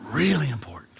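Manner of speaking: hushed whisper. Acted. Pastor to congregation, intimate whisper. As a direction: lean in and whisper it. Whispered aside after reading.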